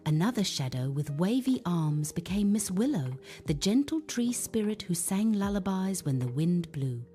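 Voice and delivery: plain reading voice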